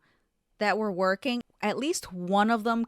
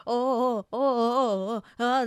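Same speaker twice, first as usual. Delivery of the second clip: higher, fake-flustered oh oh oh; mocking the bussers; 4 semitones higher, 3 dB louder than usual. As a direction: lampoon their panicked act. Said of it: "then they're like".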